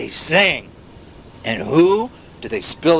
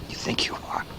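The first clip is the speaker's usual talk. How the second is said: taunting whisper; 12 dB quieter than usual. Acted. Narrator taunting the listener, whispered.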